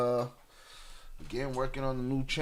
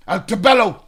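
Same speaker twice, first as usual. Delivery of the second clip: sudden loud, high-pitched outburst; explosive Godfather-style yell; carried-away movie shout; 8 semitones higher, 15 dB louder than usual. Acted. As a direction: bellow it like a dramatic film quote. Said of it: "Sorry, got carried away".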